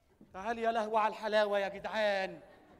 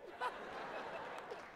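high airy villain cackle; evil laugh; 12 semitones higher, 11 dB quieter than usual. Acted. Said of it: "بضحك ضحكة شريرة".

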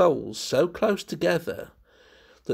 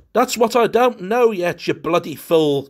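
higher, louder, exasperated yell; near scream; 6 semitones higher, 8 dB louder than usual. A narrator's reading. Curtis snapping at his friend, almost screaming. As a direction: lose patience and almost scream it. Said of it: "Curtis almost screamed".

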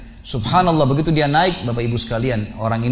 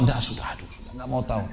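much softer, giving up; weary dismissal; 9 dB quieter than usual. Acted. Dying man refusing to bother with a quarrel, weary and quiet. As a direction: mutter it as if past caring.

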